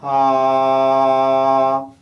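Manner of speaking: loud, long "haa" held on one pitch; ringing back-of-mouth "ah" as in car; open "ha". Shown as a demonstration. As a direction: open the throat as in a yawn and sustain "ah". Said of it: "the vowel sound R as in car".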